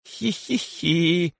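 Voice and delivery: high, slow "hee-hee-hee"; spiteful drawn-out titter; snide giggle. A narrator's reading. Korotyshka suddenly mocks the python, snide high giggle. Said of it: "вдруг раздался ехидный смех коротышки".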